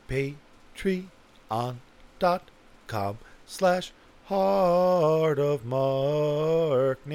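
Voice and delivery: very slow, hugely stretched syllables; drawn-out plug. Pretend. Podcaster giving the Patreon address, exaggeratedly drawn out. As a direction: spell out the address with theatrical slowness. Mock grand plug, repeated normally.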